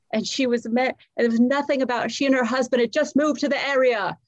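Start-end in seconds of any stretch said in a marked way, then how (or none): none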